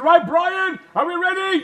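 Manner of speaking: loud high call to the set; director calling out. Retold or imitated. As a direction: call out to the actor. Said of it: "they said".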